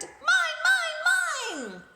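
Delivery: very high, loud parrot screech; squawking parrot. Acted. The parrot screeching at the prince over the roses. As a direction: shriek it like a grabby parrot. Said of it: "squawked the parrot".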